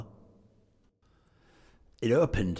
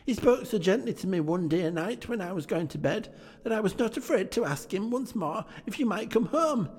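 put-on high, eager child voice; little Fran telling her brother the good news, high and excited; little girl; 13 semitones higher than usual. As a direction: chirp it like an excited little sister. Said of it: "said the child".

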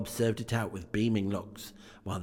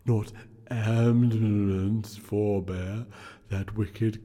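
unearthly voice; slower than usual. Acted. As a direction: intone it like a solemn spirit. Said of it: "said the ghost".

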